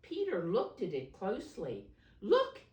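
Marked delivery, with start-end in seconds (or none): 2.2-2.6 very high, loud excited cry. Acted. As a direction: shout it out in delight. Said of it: "he cried".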